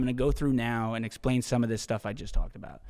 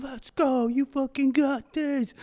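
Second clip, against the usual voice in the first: much higher, cheering call to passing runners; 13 semitones higher, slower than usual. Retold or imitated. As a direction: cheer strangers on mid-sprint. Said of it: "I'm just saying".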